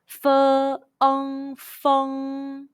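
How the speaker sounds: "Fe, ong, feng" sounded out piece by piece, high and level; high first tone. Shown as a demonstration. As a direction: hold "Fe", "ong", then blended "feng" on one flat tone. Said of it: "high pitch first tone all together".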